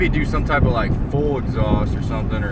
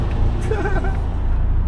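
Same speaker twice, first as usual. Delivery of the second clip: high laugh; 3 dB quieter than usual. Real, not acted. Joking about his car.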